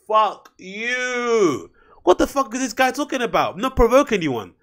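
drawn-out, high-pitched exasperated outburst; exasperated outrage. Real, not acted. Outraged reply to quoted statement.

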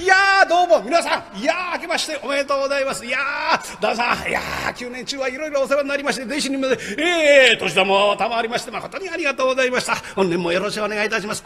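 jester's hearty greeting. Acted. Jester greeting the party upstairs, high and fast. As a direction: gush New Year greetings to the room.

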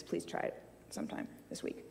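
breathy whisper of a quick plug; whispered aside. Real, not acted. Speaker slips listeners a whispered plug. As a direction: slip it in under the breath.

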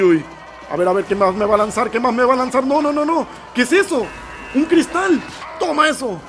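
usual speaking voice

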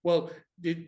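ordinary delivery

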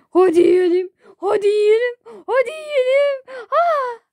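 high, loud tearful whine, climbing; hammed-up wailing. Wailing demand to eat. (ağlamaklı, giderek incelen feryat)

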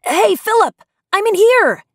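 high, loud yell for help. A narrator's reading. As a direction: shout through a locked door to a friend.

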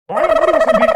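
loud shout to shut up; angry shout. Acted. Husband shouting his wife down, loud and angry.

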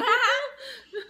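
high squealing laugh. Real, not acted. Joke about husband kissing.

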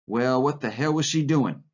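angry demand. A narrator's reading. Curly grilling George about the girl, angry. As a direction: press for an answer with moderate anger. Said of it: "watching the angry little man".

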